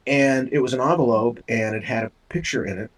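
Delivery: normal talking voice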